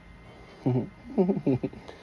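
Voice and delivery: amused laugh at own joke. Real, not acted. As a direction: laugh at your own silly accent.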